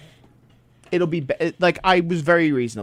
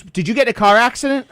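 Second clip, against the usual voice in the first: higher, louder mock brusque questioning; rude agent; 8 semitones higher, 5 dB louder than usual. TSA woman snaps at traveler, mock brusque and higher. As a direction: play the pushy officer, pitched up.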